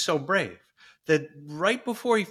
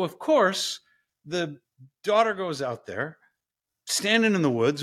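ordinary talk

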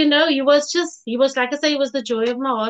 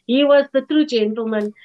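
ordinary delivery

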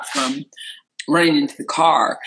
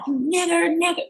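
mock scream by pitch rather than volume; retold screaming; faster than usual. Imitating the driver screaming a slur, high mock yell. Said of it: "just screaming it".

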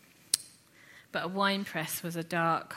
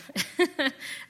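short, higher and louder chuckle; amused laugh; 9 semitones higher, 5 dB louder than usual. Real, not acted. Preacher tickled by a British Gideon, amused laugh. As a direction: break into a brief laugh.

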